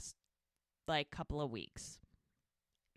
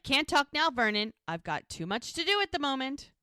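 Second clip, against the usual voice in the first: high, pointed sarcastic brush-off; retold snippy refusal, pitched up; 7 semitones higher, 5 dB louder than usual. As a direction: brush him off with loud sarcasm. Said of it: "very sarcastically".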